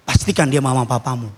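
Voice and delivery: usual speaking voice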